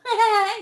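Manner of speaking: short, loud high-pitched laugh. Real, not acted. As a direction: burst out in a quick high cackle. Teasing viewer about her own videos.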